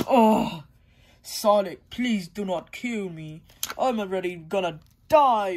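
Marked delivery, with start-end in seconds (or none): none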